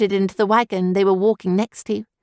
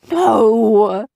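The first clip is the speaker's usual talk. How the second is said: anguished wail of no; devastated cry; 2 semitones higher, 5 dB louder than usual. A narrator's reading. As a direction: cry out in despair. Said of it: "Scout let out a cry so devastated".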